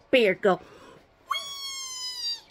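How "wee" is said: tiny high, soft wailing; pretend toy crying. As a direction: squeal out a little mock cry. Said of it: "don't cry".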